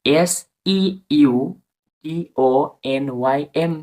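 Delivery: normal talking voice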